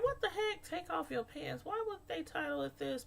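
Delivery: baffled at a silly title; mock disbelief. Reacting to episode title.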